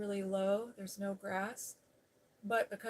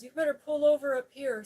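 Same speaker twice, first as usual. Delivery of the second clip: raised, louder command; agitated urging; 5 semitones higher, 10 dB louder than usual. Retold or imitated. Ex-husband telling driver to pull over, agitated and louder. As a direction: urge it loudly, still rattled.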